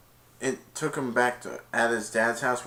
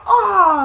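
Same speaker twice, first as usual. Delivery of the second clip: high, loud imitated cheer; cheerleaders' squeal; 13 dB louder than usual. Cheerleaders after Highland wins, loud squeal. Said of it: "all the cheerleaders went like that".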